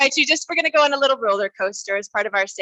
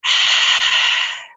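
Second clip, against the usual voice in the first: long loud breathy sigh; letting a breath land; 4 dB louder than usual. Shown as a demonstration. Leader breathing with the group to settle, long voiceless exhale. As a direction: exhale fully and audibly. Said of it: "Just to take a breath".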